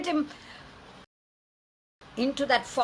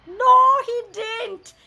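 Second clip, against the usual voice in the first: awed reveal; 10 semitones higher, 6 dB louder than usual. A narrator's reading. Elephant spares the boy.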